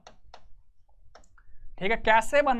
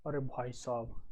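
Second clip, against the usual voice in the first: mock fright; 9 semitones lower, 14 dB quieter than usual. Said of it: "ये भी इन्होंने डरा दिया भाई हमें तो".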